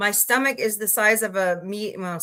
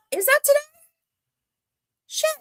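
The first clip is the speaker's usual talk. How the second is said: surprised. Real, not acted. Learns it's a holiday.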